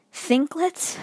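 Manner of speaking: puzzled Thinklets. Shown as a demonstration. Student baffled by the assignment name Thinklets. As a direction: ask Thinklets in disbelief. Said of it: "Let me just say that again".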